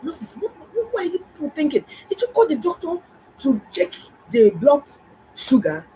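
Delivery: high, incredulous scolding of the nurses; exasperated scolding. Retold or imitated. Doctor scolding careless nurses, high and incredulous. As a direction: scold them, high and disbelieving.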